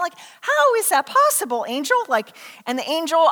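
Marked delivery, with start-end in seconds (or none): 0.4-2.0 high, puzzled challenge; baffled, pitch lifted; incredulous question. Acted. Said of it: "I'd ask probably the same question".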